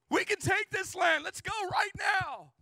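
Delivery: loud, high shout to march now; rally them to take the land; Caleb's battle cry. Retold or imitated. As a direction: Caleb shouting at the hesitant tribes. Caleb urging the people.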